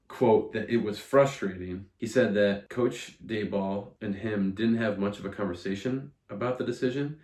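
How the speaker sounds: ordinary delivery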